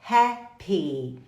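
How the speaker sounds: "Happy" split into two syllables, hap-pee; careful model pronunciation. Shown as a demonstration. Teacher asking learners to repeat, "Happy" split as hap-pee. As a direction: break "Happy" in two and hold the final ee. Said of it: "mas repita comigo".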